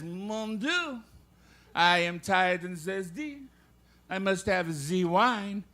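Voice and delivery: put-on slow, stagey French accent. Comedian telling a joke as the Frenchman, French accent. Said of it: "The Frenchman says".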